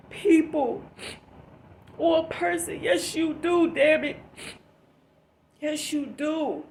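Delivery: tearful, choked-up insistence; crying. Real, not acted. Vlogger pleading with viewers, still crying and loud. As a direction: push through the tears, insisting. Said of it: "I'm snotting and crying".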